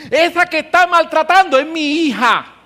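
high, loud protective voice; jealous father. Acted. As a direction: defend his daughter like an angry father. Jealous father God acted.

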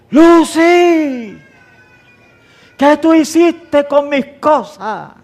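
shouting at the wife, high and loud; imitated angry shout. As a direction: yell it out over the tidied desk, slow. Acted quarrel with his wife. (grito enojado imitado, agudo y fuerte)

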